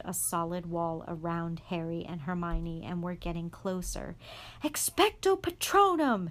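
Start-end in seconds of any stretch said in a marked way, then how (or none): 4.6-6.3 very high, loud yell. A narrator's reading. Harry yelling the spell at the Dementors. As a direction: scream the spell against the screaming. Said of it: "Harry yelled".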